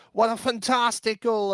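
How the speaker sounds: high, loud mock commentator cheer; excited caster call. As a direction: belt it like a caster celebrating a goal. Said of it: "everybody in the casters sort of going".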